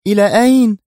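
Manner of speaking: high, loud anxious question; urgent, on-edge cry; tense. A narrator's reading. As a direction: demand it nervously, voice pitched far up. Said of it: "سألته في توتر".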